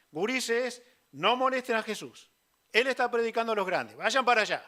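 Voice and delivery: put-on loud, scolding cry at the kids; scolding shout. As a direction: shoo the children away loudly, fast at the end. Pedro shoos children from Jesus.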